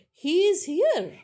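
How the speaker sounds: "He is here" as a high, loud question; "He is here" pitched well up, like a question; questioning intonation. Shown as a demonstration. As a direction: lift HERE high to ask "He is here". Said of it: "with a rising intonation turns into".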